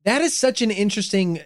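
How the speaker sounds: ordinary delivery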